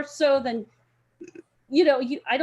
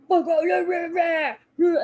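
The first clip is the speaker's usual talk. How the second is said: loud roaring shout, pitched up; mock roar; 7 semitones higher, 7 dB louder than usual. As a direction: roar to scare the guys off. Big dude scaring guys off.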